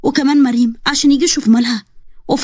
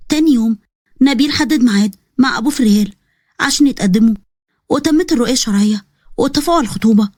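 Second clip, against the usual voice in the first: ordinary delivery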